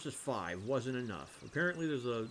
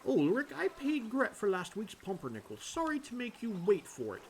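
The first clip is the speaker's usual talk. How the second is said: lightly voiced villager, higher pitch; apologetic townsperson; 10 semitones higher than usual. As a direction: speak up higher as a courteous game character.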